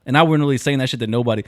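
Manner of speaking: ordinary talk, nothing marked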